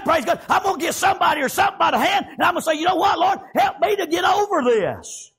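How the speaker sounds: normal talking voice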